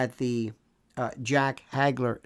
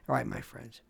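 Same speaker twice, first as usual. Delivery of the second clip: normal talking voice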